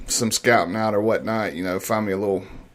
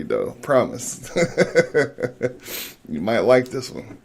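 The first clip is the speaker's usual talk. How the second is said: normal talking voice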